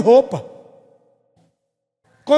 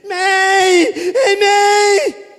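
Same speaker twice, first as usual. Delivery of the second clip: higher, louder crying shout for mom; child crying out; 8 semitones higher, 4 dB louder, much slower than usual. Retold or imitated. Preacher plays himself as a boy crying for his mother, crying shout. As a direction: wail for mother like a scared child.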